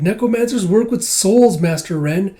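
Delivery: pleading like an anxious small creature; Kippa's voice. Retold or imitated. Kippa begging Master Ren to help Miss, high pleading voice. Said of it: "It's like".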